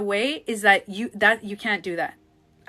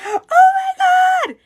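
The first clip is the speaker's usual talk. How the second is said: high, loud, drawn-out panic; mock freak-out; panicked gasp; 13 dB louder, much slower than usual. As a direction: shriek it in alarm. Acting out a triggered panic.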